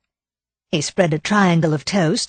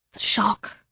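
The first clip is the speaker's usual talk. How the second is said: choked whisper; 3 semitones higher, 5 dB quieter than usual. A narrator's reading. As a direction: whisper with a lump in the throat. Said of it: "The lump in her throat silenced her".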